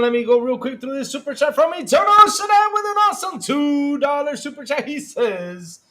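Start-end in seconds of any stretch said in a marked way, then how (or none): 0.0-4.9 shouting. Real, not acted. Rushing in before the show.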